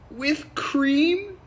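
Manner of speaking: loud, very high rising mock question; bursting anticipation. Barista shaking as he asks the setup, over-excited.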